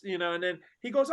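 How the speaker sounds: ordinary delivery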